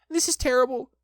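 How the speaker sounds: high, loud dismay; alarmed high-pitched thought; distressed Piglet. A narrator's reading. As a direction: despair in a squeaky little voice. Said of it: "thought Piglet".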